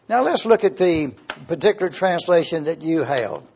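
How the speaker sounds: ordinary talk, nothing marked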